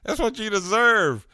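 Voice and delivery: mocking laugh. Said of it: "she was laughing at him".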